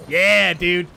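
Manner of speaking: usual speaking voice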